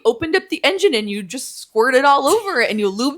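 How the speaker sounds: hammed-up breathless gushing; laughing mock gush. Heroine gushing at the tentacle monster, laughing mock gush. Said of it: "but she's like".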